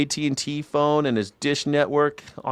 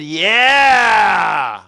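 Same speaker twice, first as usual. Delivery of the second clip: mock cheer, louder; boomers cheering; 4 semitones higher, 5 dB louder than usual. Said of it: "all the baby boomers said".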